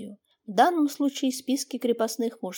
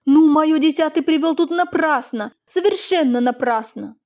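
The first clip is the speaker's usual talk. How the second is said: louder, flaring up, offended; angry; 5 semitones higher, 6 dB louder than usual. Acted. Lady, offended, answers the peasant angrily. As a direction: snap back in indignation. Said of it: "сердито понюхала табаку".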